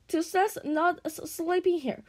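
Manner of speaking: high, moaning voice for a little dragon. A narrator's reading. Toothless protests about sleeping here, high moan. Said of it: "moaned Toothless".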